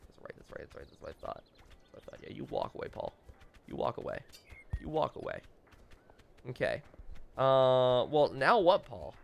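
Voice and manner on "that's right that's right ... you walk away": barely voiced, very quiet taunt; smug muttering. Player watches Paul walk away, smug muttering. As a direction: mutter it under your breath at his back.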